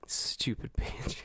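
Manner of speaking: slow whispered muttering; whispered insult. Real, not acted. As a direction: hiss it under your breath.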